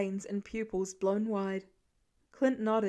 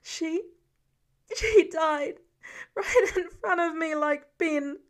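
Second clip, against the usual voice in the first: higher voice breaking into a sob; crying; 6 semitones higher, 6 dB louder than usual. A narrator's reading. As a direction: choke it out through tears. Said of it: "Peter wailed another agonized sound".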